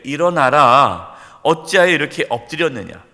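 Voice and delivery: loud, slow command to rise; commanding voice of God. Acted. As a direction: boom the divine order. God's command read aloud. (크고 느린 명령조)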